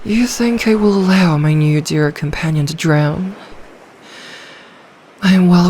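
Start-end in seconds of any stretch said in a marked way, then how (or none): none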